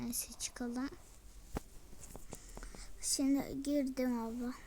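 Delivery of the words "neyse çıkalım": hushed whispered aside; whispering. Real, not acted. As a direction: whisper it, barely voiced. Quiet aside while playing. (fısıltıyla)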